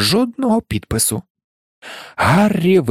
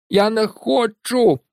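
slow, higher muffled protest from under a sweater; a muffled reluctant cry; muffled words; 8 semitones higher, slower than usual. A narrator's reading. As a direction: protest through the wool. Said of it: "долинали невиразні слова Персі".

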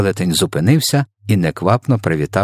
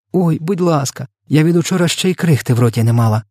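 high, eager plea; a touch of girlish excitement; delighted; 8 semitones higher than usual. A narrator's reading. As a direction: beg happily, pitched up. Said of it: "зраділа Софія".

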